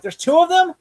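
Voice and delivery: high, loud incredulous question; mock disbelief. Retelling Gordon learning of the twin brother, high mock disbelief. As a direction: shoot the pitch up in astonishment. Acts out Gordon's shocked reaction.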